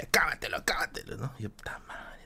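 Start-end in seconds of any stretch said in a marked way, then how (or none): 0.0-1.2 susurro de ánimo. Retold or imitated. Voz que lo anima susurrando. 1.5-2.2 susurro agotado. Retold or imitated. Agotado frente a la olla.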